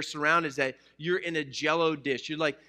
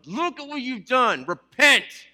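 much higher, louder, hammed-up accusing shout; mock condemning shout; 9 semitones higher, 9 dB louder, slower than usual. Church imagined scolding a sinner, mock angry shout. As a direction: bark it like a furious judge. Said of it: "we think that we're gonna tell them".

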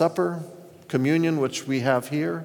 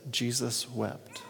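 softer and slower, reverent; hushed, slow; 2 semitones lower, 10 dB quieter, slower than usual. Acted. Preacher reading the verse to the congregation, hushed and slow. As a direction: drop almost to a hush.

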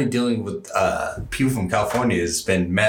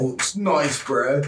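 higher-pitched California surfer bro; surfer accent; 6 semitones higher than usual. Acted. Cohost chimes in on the California skit, surfer accent. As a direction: toss in a mock surfer accent. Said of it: "Like the surfer accent".

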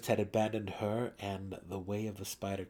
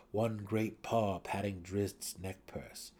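ordinary delivery

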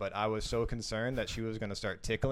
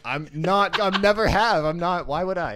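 imitating her insistent refusal, higher and louder; retold indignant denial; 8 semitones higher, 7 dB louder than usual. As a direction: protest as she did, raised and emphatic.

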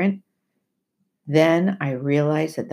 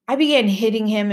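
usual speaking voice

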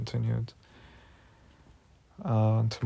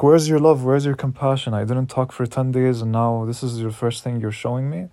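retold angry yell; 6 dB louder than usual. Repeating the furious questions to the teacher, loud and angry. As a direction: fling the questions at her in outrage. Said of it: "really, really mad at her".

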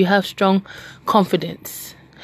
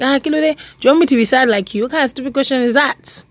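high, mock-offended comeback; sassy retort; 6 semitones higher, faster than usual. Teacher scoffing at the student's question, high and sassy. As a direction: toss it back with mock indignation. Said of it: "I was like".

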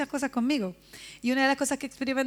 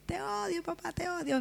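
put-on child's tearful outburst; 5 semitones higher, 6 dB quieter than usual. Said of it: "imagínense con la ira".

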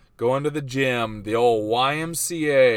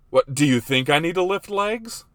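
higher, louder offended challenge; mock indignation; 5 semitones higher, 6 dB louder than usual. Wife challenging husband about lifting legs, mock offended. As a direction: imitate an affronted wife.

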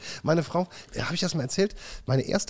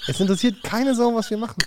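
high, louder repeat for emphasis; 9 semitones higher than usual. Real, not acted. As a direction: say it again, insisting. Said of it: "Was hast du gerade erzählt?".

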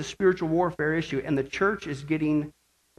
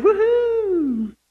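high, long woohoo; joyful whoop; 6 dB louder than usual. Pretend. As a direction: let out a drawn-out cheer. Cheers for the millennium.

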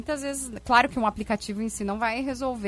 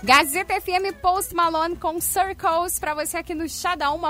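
bright, loud station ident; radio announcer; 6 semitones higher, 4 dB louder than usual. Acted. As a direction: sell the station like a radio host.

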